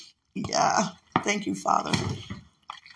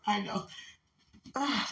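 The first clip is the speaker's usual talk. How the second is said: ordinary delivery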